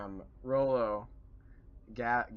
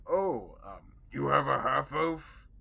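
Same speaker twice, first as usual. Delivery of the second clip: orc voice; 4 semitones higher, 7 dB louder, slower than usual. Acted. Orcs surprised.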